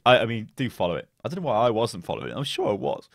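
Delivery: usual speaking voice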